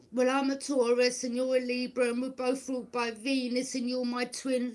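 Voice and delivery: high, loud put-on mimicry of the sister; mocking sister. Mimicking the manipulative sister claiming they're twins, high and loud. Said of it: "she's like".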